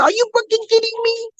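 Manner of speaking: very high-pitched fake Italian outrage; mock Italian accent. Ruthless Italian challenging someone, mock Italian indignation. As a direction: try a bad Italian accent, squeezed way up high. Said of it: "that accent. But of course, I tried".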